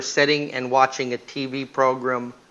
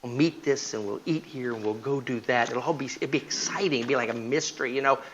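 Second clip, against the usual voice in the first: secretive hush; 8 dB quieter than usual. Retold or imitated. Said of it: "cheater explains secret thrill".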